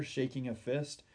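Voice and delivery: ordinary delivery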